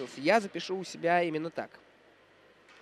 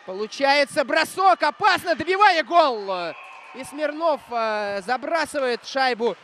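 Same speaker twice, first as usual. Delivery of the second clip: rising shout, faster, louder; excited hockey goal call; 6 semitones higher, 6 dB louder, slower than usual. Real, not acted.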